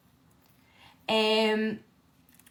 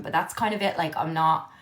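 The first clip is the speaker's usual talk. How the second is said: usual speaking voice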